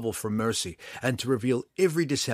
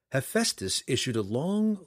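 usual speaking voice

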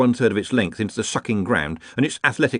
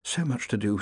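muttering; 6 dB quieter than usual. A narrator's reading. Ponder leafing through paperwork, muttering softly. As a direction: mutter it softly under the breath. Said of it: "muttered Ponder".

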